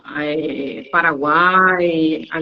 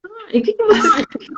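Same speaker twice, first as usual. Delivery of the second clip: much higher, mock curious; teacher's probing question; 13 semitones higher than usual. Teacher asks student what they did, much higher and mock curious. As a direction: probe innocently in a high voice. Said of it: "Eu falo assim".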